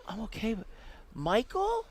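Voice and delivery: put-on high, wavering confused old woman; old gambler mistaking the dealer for someone else, confused and high; dazed old lady. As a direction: sound lost and startled.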